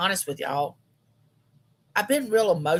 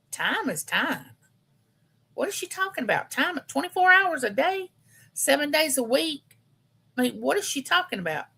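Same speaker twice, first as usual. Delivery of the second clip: much higher, incredulous mock scoff; scoffing disbelief; 8 semitones higher than usual. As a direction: brush off grandma's saying as nonsense. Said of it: "And I would think".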